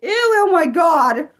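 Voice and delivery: scared shriek. Real, not acted. Reacting to horror pictures.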